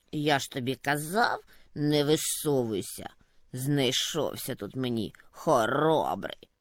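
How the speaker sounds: low, sour muttering; snowdrift grumbling. A narrator's reading. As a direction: grumble told-you-so. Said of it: "буркотів замет". (низьке буркотіння замету)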